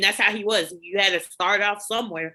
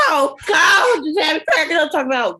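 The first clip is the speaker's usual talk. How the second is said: normal talking voice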